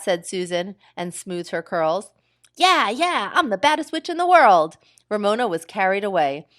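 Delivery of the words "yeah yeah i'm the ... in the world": high, loud gleeful witch taunt. Acted. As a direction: taunt with delight. Ramona gleeful chant.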